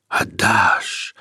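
whispered roaring flame. Acted. The fire demanding Peter's heart, whispered roar. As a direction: breathe it like crackling fire. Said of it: "шумело пламя".

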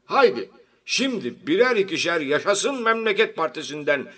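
high, loud rallying oratory; party chairman's speech. A narrator's reading. Party chairman urges members to switch parties, declamatory. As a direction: proclaim it to the whole meeting, emphatic.

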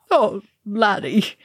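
slow, tearful sigh; weeping. A narrator's reading. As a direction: weep the words out. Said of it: "he wept".

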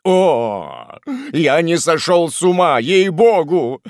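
loud, drawn-out wail of protest; whining, loud lament; tearful wailing. Acted. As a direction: wail as if starting to cry. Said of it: "заголосил жирный парень, начиная плакать".

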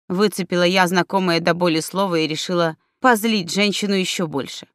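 ordinary delivery

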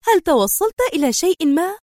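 curious. A narrator's reading. Woman asks whether he worked something out, curious. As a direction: ask with curiosity, voice lifted. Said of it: "فسألته منى في فضول".

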